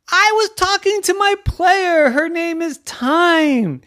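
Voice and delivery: very high, loud yell; exasperated shouting. Acted. Coach explains a player's name to the ref, exasperated yell. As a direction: yell it out, stretching the end.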